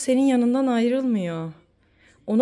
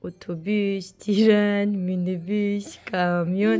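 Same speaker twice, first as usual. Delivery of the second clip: playful countdown; much slower than usual. Pretend. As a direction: draw out each word before the beep. Nose-beep game on the child.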